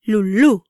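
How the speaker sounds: "Lulú" said lu-LU, emphatic ending; stress on accented syllable. Shown as a demonstration. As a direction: hit the last syllable of "Lulú". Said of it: "pronounced louder and with more emphasis".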